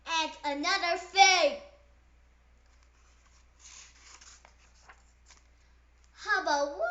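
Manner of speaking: indignant outburst. Acted. Pigeon ranting at the duckling, loud and indignant. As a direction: blurt it out in a huff.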